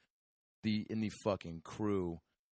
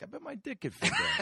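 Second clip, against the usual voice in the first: high silly voice. Retold or imitated. Mocking Brad's teen thoughts.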